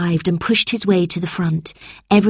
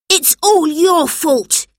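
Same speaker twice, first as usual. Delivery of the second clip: higher, louder and slower accusation; a boy standing up to his boss; angry shout; 11 semitones higher, 4 dB louder, slower than usual. A narrator's reading. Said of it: "he shouted at Mister Bleak".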